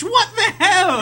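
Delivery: high-pitched, laughing disbelief; incredulous outburst. Real, not acted. Co-host recounting a wild TV episode, incredulous. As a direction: burst out high, half laughing, appalled. Outraged at a show's absurd plot.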